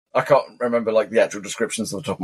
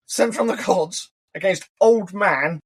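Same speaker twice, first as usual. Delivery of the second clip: mock reverent; 7 semitones higher, slower than usual. Mocking fans' view of Dumbledore.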